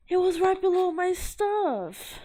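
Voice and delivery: high, loud, drawn-out whine; frustrated wail. Real, not acted. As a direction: wail over the thing you misplaced. Upset searching for lost sticker.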